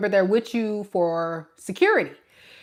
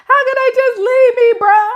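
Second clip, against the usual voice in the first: much higher, louder mock wail, close to crying; whiny crying child; 9 dB louder than usual. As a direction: wail it like a kid about to cry. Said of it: "whining and complaining like a child".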